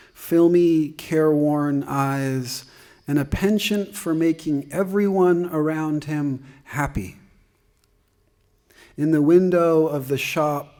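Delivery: projected storytelling in verse; poetry reading. Acted. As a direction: read the verse out to the room. Reads narrative poem to audience.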